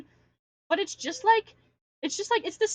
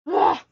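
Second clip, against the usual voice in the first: frustrated groan; 5 semitones higher, 11 dB louder than usual. Real, not acted. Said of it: "I'm so mad".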